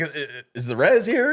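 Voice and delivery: high, loud panicked squeak; mock nervous squeak. Friend mocks the nervous guest, squeaky high panic. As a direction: squeak it out like a scared kid. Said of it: "You're like".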